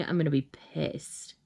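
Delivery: ordinary delivery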